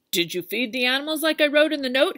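lightly voiced farmer; Farmer Brown on the phone. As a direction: farmer checking up, a bit anxious.

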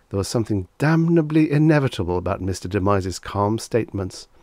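ordinary delivery, nothing marked

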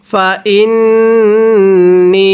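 ফা ইন্নি with ন্ন hummed long through the nose; ghunnah. Shown as a demonstration. Teacher demonstrates nasal ghunnah, slow. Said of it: "নাকের বাসি দ্বারা গুন্নাহ করব".

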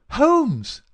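insistent rousing shout. A narrator's reading. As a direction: shout to wake a sleeper. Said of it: "in the insistent tone of one who awakens".

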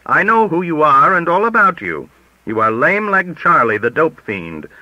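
high, loud accusation; crying out. A narrator's reading. Rejected applicant turning on the editor, crying out in accusation. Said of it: "he cried".

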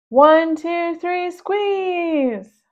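loud, high count to squeeze; calling out count. Acted. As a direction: shout the count like a workout.

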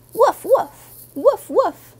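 high, loud woofs of an excited puppy; loud puppy barking. Acted. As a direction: bark it out like a little dog, high and loud. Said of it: "That's too loud for inside the library".

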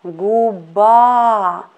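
Guba with the last A stressed, gu-BA; stress on final A. Shown as a demonstration. Teacher showing students where Guba is stressed. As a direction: lean on the end of Guba, gu-BA. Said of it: "Stress to letter A".

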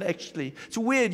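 ordinary talk

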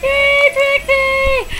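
high, loud puppy cheer. Pretend. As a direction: squeal a big yay for the puppy. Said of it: "Everybody say yay for Trixie".

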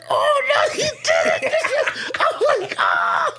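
very high strangled cry of outrage; hammed-up outburst of fury; mock rage squeal. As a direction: shriek high with pent-up fury, laughing. Bursting with rage, laughing.

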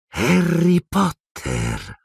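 slow, high, drawn-out hiss; snake-like hiss from the face on the back of a head. Acted. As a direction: hiss the name like a serpent. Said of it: "sibilò".

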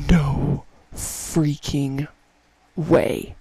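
gasp, then a slow drawn-out no freaking way; stunned disbelief, dragged out slowly. Real, not acted. Gamer gasping at a rare chameleon drop, stunned.

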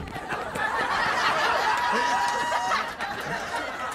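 a breathy high-pitched giggle; real wheezy giggle at his own joke; high wheezing laugh. Real, not acted. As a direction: laugh up high, nearly silent. Comedian cackles at own joke.

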